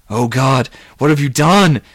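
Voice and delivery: loud, horrified yell; appalled outburst. A narrator's reading. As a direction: yell it aghast, pitch climbing. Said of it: "Mark yelled".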